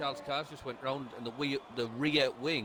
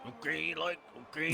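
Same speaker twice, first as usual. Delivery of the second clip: strained constipated voice; 5 semitones higher, 3 dB quieter than usual. Retold or imitated. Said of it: "Sounded like he was constipated".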